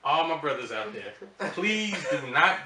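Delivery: usual speaking voice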